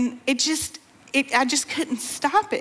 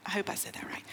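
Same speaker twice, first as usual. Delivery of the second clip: much softer, whispery aside; hushed aside; 3 semitones lower, 15 dB quieter, much faster than usual. Real, not acted. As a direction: mutter it under your breath. Self-doubting aside after foreign words.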